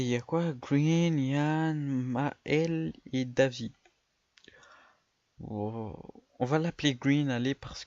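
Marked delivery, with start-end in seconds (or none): none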